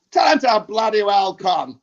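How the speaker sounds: high, loud, drawn-out bellow; slow, indignant mock holler; London shout. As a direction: imitate a Londoner yelling from the background. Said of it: "he's from London, and he says".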